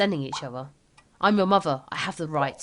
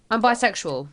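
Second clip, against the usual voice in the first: usual speaking voice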